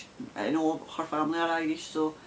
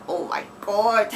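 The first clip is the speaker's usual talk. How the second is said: slow, loud, put-on dismay; mock horror; 4 semitones higher, 6 dB louder, slower than usual. Knitter reacting to praise of her accent, mock horror. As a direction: groan it out in mock despair. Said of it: "I'm like".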